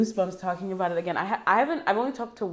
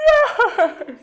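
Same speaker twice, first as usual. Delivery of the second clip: high, loud yes laughing through tears; joyful shout; 12 dB louder than usual. Real, not acted. Seeing the childhood show again, loud laughing-crying yes. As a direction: burst out in happy half-crying.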